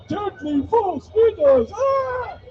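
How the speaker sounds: high, slow hammed-up cry; mock tabloid panic. Mocking newspaper scare headlines with a shriek. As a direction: scream the headline in mock terror. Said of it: "you've seen the fair".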